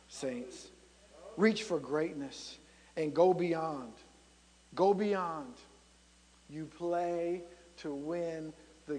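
low, soft preaching with long pauses; hushed solemn exhortation. Real, not acted. Preacher drops to slow hushed exhortation.

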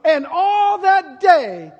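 high, loud, slow climactic shouting; preaching at full force. Acted. Preacher climaxing the fig tree lesson with a shout. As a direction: drive it home to the congregation.

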